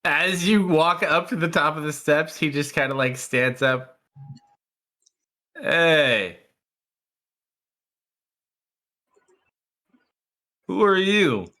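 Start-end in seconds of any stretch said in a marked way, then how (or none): 5.6-11.5 game master's guard calling out a challenge; raised, higher-pitched guard challenge; guard's challenge. Acted. Guard challenging the player at the top of the steps, guard's challenge.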